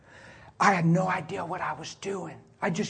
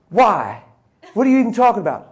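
angry; 5 semitones higher, 10 dB louder than usual. Retold or imitated. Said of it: "he got really mad".

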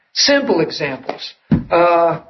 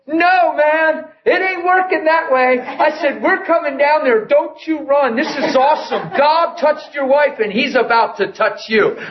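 high-pitched calling-out, mock yell; retold yelling; 8 semitones higher than usual. Preacher to husband down the store, yelling. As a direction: call it high down a long aisle. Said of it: "I'm yelling down the store".

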